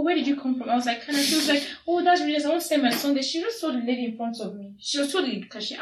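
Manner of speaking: ordinary talk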